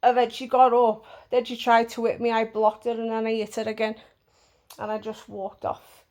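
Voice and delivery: usual speaking voice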